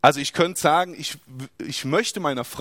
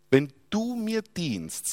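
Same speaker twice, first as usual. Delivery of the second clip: quoting the Lord; 3 semitones higher, slower than usual. Retold or imitated. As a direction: let it sound like a quoted promise, slow and weighty. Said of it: "sagt der Herr". (langsam und feierlich zitiert, ein Hauch leiser)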